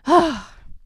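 loud high sigh letting the tension out; big exasperated sigh. Real, not acted. Host sighs after her passionate rant to listeners, loud release. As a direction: blow it all out in one sigh. Worked up about body image.

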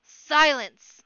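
one loud, high burst of authority; commanding shout. A narrator's reading. Chief silencing the terrified warren, commanding shout. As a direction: roar it to quell a panicking crowd. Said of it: "in his great commanding voice".

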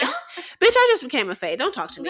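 high-pitched mock indignation; sassy brush-off. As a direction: shut them down with playful attitude. Voices sister snubbing her mate.

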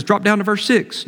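ordinary talk, nothing marked

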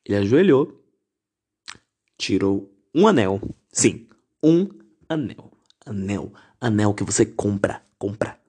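usual speaking voice

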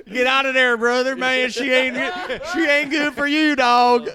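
loud, high, laughing warning; shouted warning. Real, not acted. Warning friend off a bad girl, shouted. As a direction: yell it at him to get out. Friends urging him to leave.

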